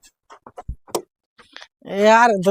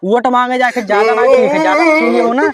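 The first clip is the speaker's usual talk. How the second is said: slurring and laughing after a few drinks; drunk with hiccups; 3 semitones higher than usual. Acted.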